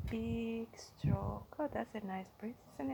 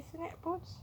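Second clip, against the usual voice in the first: ordinary delivery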